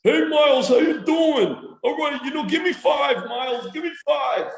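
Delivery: grandpa's high, hearty "give me five"; dad's voice. Retold or imitated. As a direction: pitch way up as grandpa cheering the baby. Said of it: "My dad is this one voice".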